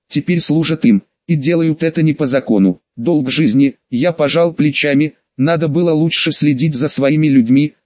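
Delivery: ordinary delivery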